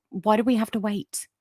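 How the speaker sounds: usual speaking voice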